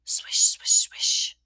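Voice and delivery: loud breathy sweeping sounds; whispered swish noises. Acted. Narrator imitating the broom on stone steps, loud whisper. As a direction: hiss out each swish like a broom stroke.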